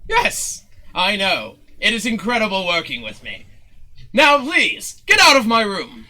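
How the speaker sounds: high, loud, grand self-congratulation; boast, then dismiss them imperiously; pompous showman. Acted. Showman sending Zook out of his room, pompous.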